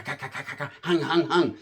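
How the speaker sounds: spat-out mouth gunshots; imitated gunfire. Night in Chicago, mimicking gunshots. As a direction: rattle off gunfire noises with the mouth. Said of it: "all you hear is".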